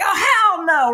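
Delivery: high, loud hell no; defiant yell. Retold or imitated. Rider fighting off a teenage biker, loud defiant cry. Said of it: "I'm like".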